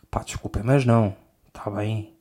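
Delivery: breathy unvoiced sorry; a hushed, sheepish sorry; whispered apology. Real, not acted. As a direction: whisper the sorry, half-mocking.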